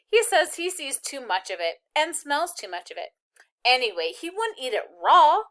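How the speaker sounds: silly chatter. Acted. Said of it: "tried to giggle the way her sister might".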